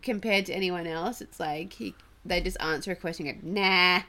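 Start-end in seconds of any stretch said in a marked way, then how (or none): 3.6-4.0 loud, broad Aussie Nah. Retold or imitated. Showing how Aussies answer questions, loud Aussie accent. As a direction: throw out Nah with Australian twang. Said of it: "how, like, an Aussie".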